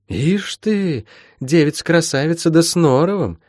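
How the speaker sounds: high-pitched, wry teasing; lightly mocking admiration; amused scoff. A narrator's reading. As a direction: tease with a chuckle behind the words. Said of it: "усмехнулась целительница".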